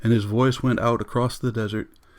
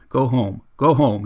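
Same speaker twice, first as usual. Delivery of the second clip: mild shouted go home, louder; yelling; 2 semitones higher, 6 dB louder than usual. Acted. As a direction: Montague yelling at Missus Bowles to get out, a narrated shout. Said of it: "he yelled".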